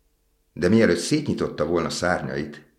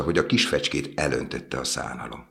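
plain reading voice

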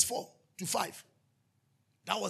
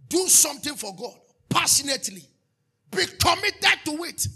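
preaching shout; 6 semitones higher, 8 dB louder than usual. Acted. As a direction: drive it home with full force. Preacher exhorting at full force.

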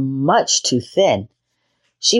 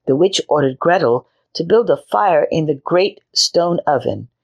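plain reading voice

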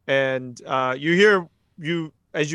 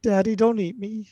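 put-on higher pleading child's voice; little girl voice; 6 semitones higher than usual. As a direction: beg like a small scared girl. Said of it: "the voice of a little girl".

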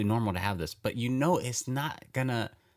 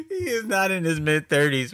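higher, louder mocking repeat of "He is not in his mid-thirties"; mock outrage; 7 semitones higher, 3 dB louder than usual. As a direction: throw the line back in disbelief, louder and higher. Repeats line, mocking emphasis.

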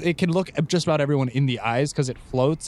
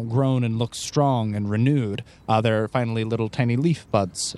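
scene narration; 5 semitones lower than usual. A narrator's reading. GM sets the scene.

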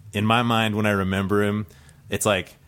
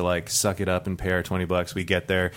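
ordinary talk, nothing marked

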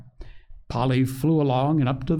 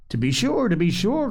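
put-on higher, eager old lady agreeing; a touch of glad fussing; delighted cry; 6 semitones higher than usual. A narrator's reading. Grandma eager to give advice, mildly delighted. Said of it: "cried the old lady, delighted beyond measure".

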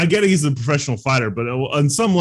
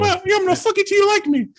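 very high mock Mike Tyson voice; high Tyson lisp. Friend mocks Mike Tyson's voice, very high and lisping. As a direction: squeak it out like a lisping boxer.

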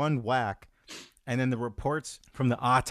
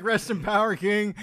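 higher, imitating his own toast; mock solemn toast; 9 semitones higher, 4 dB louder than usual. Friend replaying his toast to the dead YouTuber, mock solemn. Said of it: "I'm like".

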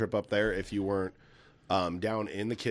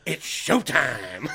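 drawn-out showman's call; Beetlejuice catchphrase; 4 dB louder, much slower than usual. Retold or imitated. Dad showing how Beetlejuice goes, drawn-out theatrical call. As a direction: stretch "It's showtime" very slowly and theatrically. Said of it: "You gotta go".

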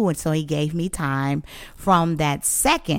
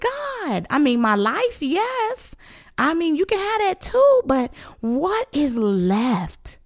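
high, pleading wail to God; slow, stretched ending on an anguished plea; retold crying plea; 10 semitones higher than usual. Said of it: "And I cried".

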